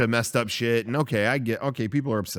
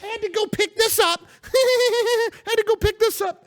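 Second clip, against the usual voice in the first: very high, strained coworker voice with a laugh; impression of Kevin; 3 dB louder than usual. Mocking late coworker Kevin as he walks in, high squeaky impression. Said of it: "I'm gonna do my impression of him".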